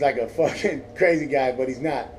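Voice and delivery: ordinary talk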